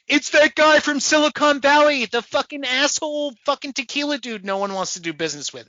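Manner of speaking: high, loud excited blurt; high loud excited recognition. Retold or imitated. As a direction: shout it as you recognise him. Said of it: "and I was like".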